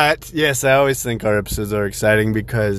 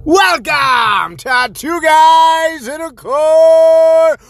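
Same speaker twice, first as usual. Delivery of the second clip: ringmaster; 3 dB louder, much slower than usual. Acted. Podcast intro announcer style.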